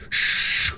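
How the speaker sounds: mock shaker noise; unvoiced shh of salt being shaken; shaking sound. Sandra shaking salt onto the chips, imitated with a shh. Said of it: "And she just goes".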